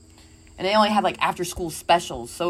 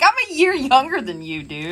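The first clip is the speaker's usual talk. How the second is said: exasperated retort; 8 semitones higher, 4 dB louder than usual. Retold or imitated. Retold exasperated reply.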